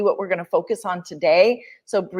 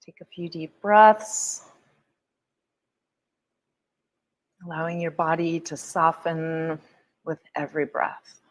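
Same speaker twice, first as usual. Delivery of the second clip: guided meditation; 4 semitones lower than usual. Acted. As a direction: ease them into stillness, gently.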